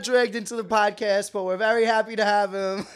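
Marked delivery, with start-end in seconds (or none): none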